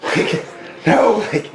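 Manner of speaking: high, hurried fake pleading; mock panicky plea. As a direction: play the trapped fighter begging for a second.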